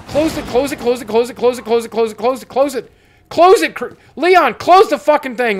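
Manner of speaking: high, loud, repeated panicked command; frantic urging. Real, not acted. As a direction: yell at Leon to shut it. Urging Leon to shut door.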